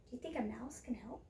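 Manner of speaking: put-on hushed, secret question to the children; whispered aside. A narrator's reading. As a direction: lean in and whisper it. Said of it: "aside question to child listeners".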